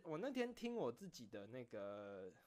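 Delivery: usual speaking voice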